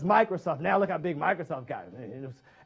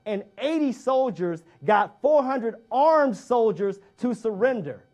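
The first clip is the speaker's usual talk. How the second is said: normal talking voice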